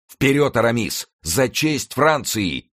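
high rallying cry, slowing grandly; heroic exclamation. A narrator's reading. Athos rallies Aramis for France, high heroic cry. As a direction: urge your comrade on, stretching the ending. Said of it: "воскликнул".

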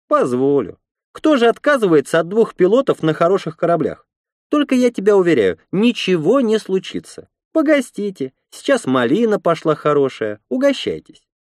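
high-pitched welcoming woman's voice; red-haired woman, hospitable. A narrator's reading. Red-haired woman lets pilots stay and offers berries, high hospitable voice. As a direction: welcome guests in a high character voice.

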